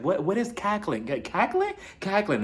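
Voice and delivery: halting attempts at an unfamiliar "cackling"; trying to pronounce. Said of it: "she's trying to pronounce it".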